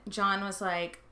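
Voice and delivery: ordinary talk, nothing marked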